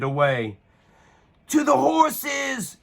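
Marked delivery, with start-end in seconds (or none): 1.5-2.8 high, slow rallying shout; rally the knights to ride; shouted command. A narrator's reading. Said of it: "shouted Uniqua".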